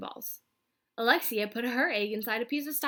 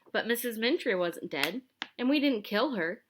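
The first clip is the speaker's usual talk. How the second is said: plain reading voice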